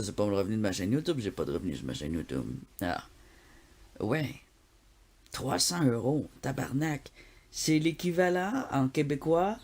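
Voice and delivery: usual speaking voice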